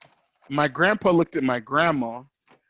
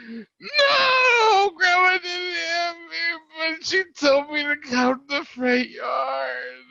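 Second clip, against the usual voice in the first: mock crying; 11 semitones higher, slower than usual. Child self crying to grandpa.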